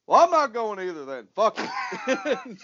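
lightly voiced drawling friend; Ben Snur voice. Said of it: "my Ben Snur voice".

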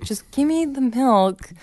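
mildly hammed-up child's tantrum, slow and high; imitated pissed-off child. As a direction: demand it like a cross little kid. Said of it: "she got pissed at me".

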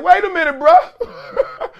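high, laughing wait a minute; incredulous squeak, half laughing; stunned disbelief. Retold or imitated. Player to his coach in meeting, high laughing disbelief. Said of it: "I'm like".

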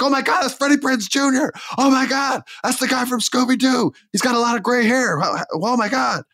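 high, far-off gasping fan voice; shocked crowd. Pretend. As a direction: play the stunned crowd member. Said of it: "They'd be like".

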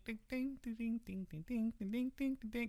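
soft, high repeated dings; imitated truck jingle. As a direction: chime like a distant ice cream truck. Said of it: "you just hear ding".

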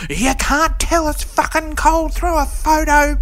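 hammed-up disbelief; mock scoff. Scoffing at the refund request.

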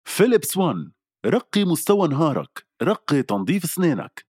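high, slow product tagline; ad read. Acted. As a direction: announce the brand slogan. Reads radio ad script.

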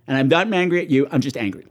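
usual speaking voice

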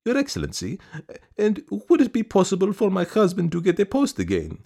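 high, mincing lady's voice; woman sweetly asking for her husband's post; sweet coquettish request. A narrator's reading. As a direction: ask high and sweetly, fishing for a favour. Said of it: "sweet, mincing, even coquettish smile".

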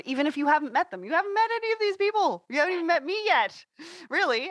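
eager, quickening reassurance; excited. Real, not acted. Host reassures guest warmly.

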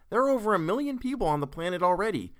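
high, emphatic character voice; exasperated protest. A narrator's reading. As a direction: argue it with rising exasperation. Character arguing exasperatedly in read story.

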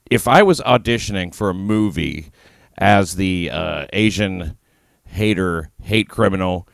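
ordinary talk

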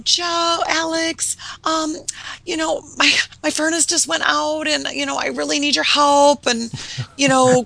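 high, pleading helpless voice; damsel in distress. Retold or imitated. Calling a crew guy at night, high pleading damsel voice. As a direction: play the helpless woman calling late at night. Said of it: "damsel distress".

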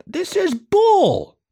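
very high, slow, drawn-out squeal of protest; Perry yelling at the missing pretzels. Acted. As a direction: stretch out bull in high outrage. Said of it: "Perry raised his voice".

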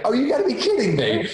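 high, mock-exasperated protest with a laugh; laughing disbelief. Makeup artist reacting to the boss's request, in amused disbelief.

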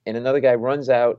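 ordinary talk, nothing marked

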